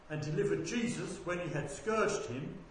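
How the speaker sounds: ordinary delivery, nothing marked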